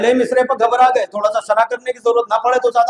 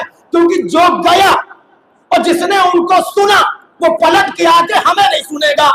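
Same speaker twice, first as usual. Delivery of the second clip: raised, driving sermon oratory building higher; full-force preaching; 5 semitones higher, 4 dB louder than usual. Real, not acted. Preacher exposing those who bar the way, impassioned climax.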